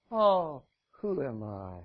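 low, sighing fake modesty; mock humility. Moses pretending to be humble before God. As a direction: play false humility.

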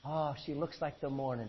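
soft, lilting Irish brogue, lightly done; a playful hint of an Irish lilt; Irish accent. Preacher jokingly reading scripture to congregation in an Irish accent. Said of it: "Doesn't that sound like an Irish guy talking?".

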